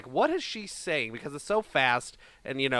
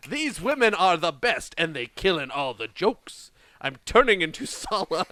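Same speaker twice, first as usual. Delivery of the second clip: raised, hammed-up British accent; 2 semitones higher, 5 dB louder than usual. Podcaster finishing the rap lyrics, mock British accent. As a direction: carry on declaiming in mock British. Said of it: "Read it in your British accent".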